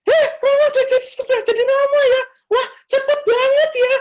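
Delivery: very high, loud squeal of greeting; same yelling voice. Retold or imitated. Neighbour's mother greeting the priest, shrieking high and loud. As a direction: shriek the greeting like she drilled her son. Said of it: "Sama aja".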